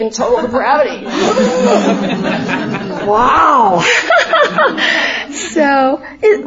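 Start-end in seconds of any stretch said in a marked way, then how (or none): 1.1-3.0 laughing. Real, not acted. Laughs at total depravity joke. 3.9-5.3 laughing. Real, not acted. Laughing after nursery joke.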